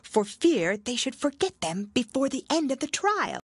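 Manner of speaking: high Gryphon voice, hushed reply; Gryphon's voice. A narrator's reading. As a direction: keep the Gryphon high and secretive. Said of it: "the Gryphon whispered in reply".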